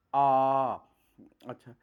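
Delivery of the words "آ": long open "آ" said out loud and held; vowel demo. Shown as a demonstration. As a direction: sound out "آ" clearly from the throat. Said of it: "باہر کو رخ ہوتا ہے".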